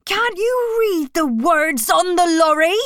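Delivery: high, loud, exasperated yell; angry shout. A narrator's reading. Benjamin angrily shouts at animals who missed the warning. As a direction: demand it furiously, voice pitched high. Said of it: "was very angry".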